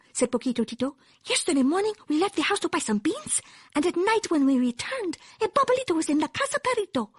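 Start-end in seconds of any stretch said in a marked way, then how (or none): 1.2-7.1 high little dog voice; tiny chihuahua. A narrator's reading. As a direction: report the trouble in a high, small voice. Tiny chihuahua reports, put-on voice.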